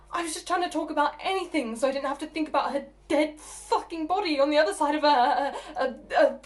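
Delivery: agitated rant building to a loud peak; furious, stumbling build to a shouted finish; angry outburst. Acted. Agitated outburst about mother's funeral.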